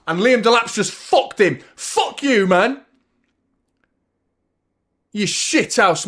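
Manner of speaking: loud, high, swearing at the player; furious rant. Real, not acted. Angry fan yelling at a former player after the foul, furious. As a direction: shout abuse at the screen.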